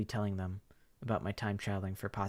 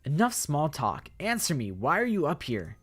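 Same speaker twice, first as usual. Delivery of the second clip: loud, high, slow confrontation; demanding girl; 5 semitones higher, 6 dB louder, slower than usual. Acted. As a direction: demand he answer now.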